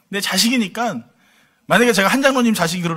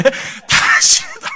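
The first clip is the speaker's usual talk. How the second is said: high, very slow, drawn-out whine; mock son's whine; 4 dB louder, much slower than usual. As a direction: stretch it out like a sulking kid. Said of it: "자기만 씨가 아니래". (칭얼대는 아들 흉내)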